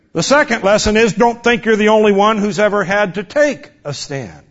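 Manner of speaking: ordinary talk, nothing marked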